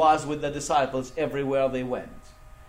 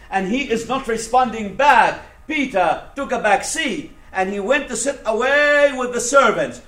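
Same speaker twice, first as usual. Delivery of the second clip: impassioned preaching; 6 semitones higher, 10 dB louder than usual. Acted. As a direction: preach the story with mounting force. Preaches Peter's denial at full force.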